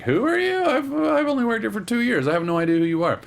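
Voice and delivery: a hint of a confused employee; puzzled receptionist. Host voicing the receptionist who doesn't know Danny, lightly baffled. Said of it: "she's like".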